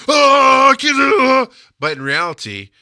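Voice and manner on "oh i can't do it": high, loud panicked cry; a sudden loud wail of self-doubt; inner panic. Retold or imitated. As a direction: let the inner voice crack in alarm. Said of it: "I feel like I'm going".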